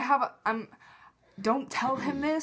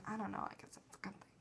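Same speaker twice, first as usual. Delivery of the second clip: barely audible, quick whisper; whispered aside; 19 dB quieter, faster than usual. Real, not acted. Fan doubting that his looking was good, whispered aside. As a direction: whisper it away to yourself. Self-conscious trailing aside.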